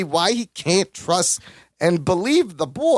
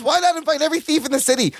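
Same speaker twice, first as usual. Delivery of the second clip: higher and fast sarcastic cry; freaking out; 8 semitones higher, faster than usual. Retold or imitated. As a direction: rattle it off high and quick, losing it. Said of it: "Larry is just freaking out".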